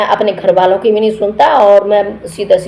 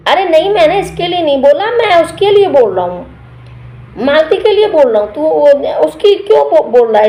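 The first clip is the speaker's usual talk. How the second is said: much higher, put-on defensive voice; mock innocent denial; 7 semitones higher than usual. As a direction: play the wide-eyed one wriggling out of blame. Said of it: "फिर बोलता है [name]".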